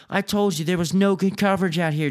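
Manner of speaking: usual speaking voice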